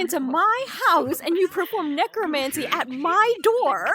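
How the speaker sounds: loud, high, angry accusation; angry mouse. Acted. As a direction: scold the intruders loudly. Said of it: "Moyu was a very angry mouse".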